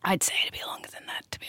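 quiet whispered aside; whispering. Real, not acted. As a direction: drop to a whisper for the joke. Joking aside about hair length.

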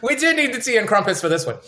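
quick, high mock English accent. Answering the crumpets joke in a mock English accent. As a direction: play along in a hammed-up English accent. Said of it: "my English accent".

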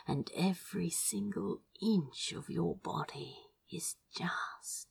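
adoring whisper fading away. A narrator's reading. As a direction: whisper it, trailing off, awestruck. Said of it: "he whispered".

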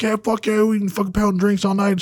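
high, hyped-up party boast; mock hyped bro; hyped drinking bravado. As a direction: pump it up like a drunk buddy. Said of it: "where I was like".

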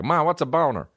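put-on high-pitched little-kid question; imitated young kid. Young cousin asks mom what's a boner, high mock-kid voice. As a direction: voice a kid asking his mom.

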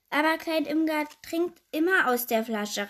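mildly called-out objection; calling out. A narrator's reading. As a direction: pipe up loudly as a little princess. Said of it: "rief Prinzessin Orangerie".

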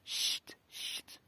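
breathy unvoiced shush, fading away. A narrator's reading. Hatter and March Hare hush Alice, shushing. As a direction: hush her sharply. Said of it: "lui firent".

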